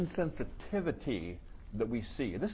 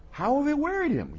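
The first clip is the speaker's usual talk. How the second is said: high, louder whiny protest; mock indignant complainer; 13 semitones higher, 6 dB louder than usual. Preacher mimics Israel doubting God's love, whiny mock protest. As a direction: squeak out a petulant complaint. Mocking the people questioning God.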